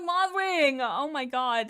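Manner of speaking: excited gush. Real, not acted. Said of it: "And I'm like".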